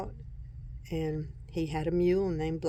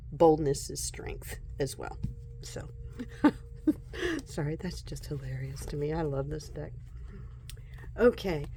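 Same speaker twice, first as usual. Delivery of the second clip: ordinary talk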